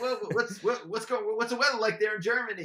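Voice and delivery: mock employee chatting up the German caller; friendly chatter. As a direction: keep the buddy chatter going, a bit rushed. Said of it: "They go".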